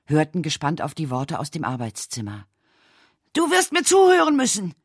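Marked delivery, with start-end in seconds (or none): 3.3-4.7 high, loud furious roar; bellowing. Acted. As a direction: bellow it at the man behind the door. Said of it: "brüllte Edgar".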